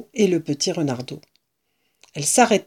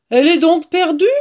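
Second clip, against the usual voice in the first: high, loud question; dismayed call; 10 semitones higher, 7 dB louder, faster than usual. A narrator's reading. Woman asks about the lost tail, dismayed call. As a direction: call up for confirmation.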